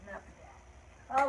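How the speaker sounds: normal talking voice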